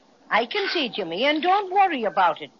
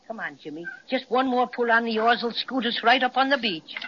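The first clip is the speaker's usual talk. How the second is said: ordinary talk, nothing marked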